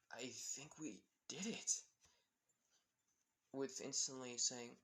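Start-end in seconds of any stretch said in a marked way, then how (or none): 0.1-1.8 hushed, breathless whisper; awed whisper. Acted. Melissa removing her goggles, whispering in disbelief. Stunned after Eri vanishes.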